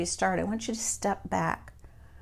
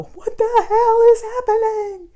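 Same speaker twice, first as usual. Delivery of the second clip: high, loud, comic cry of exasperation; mock exasperated yell; 13 dB louder, slower than usual. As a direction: throw up your hands and yell it, half joking. Quoting teacher's frustrated hour.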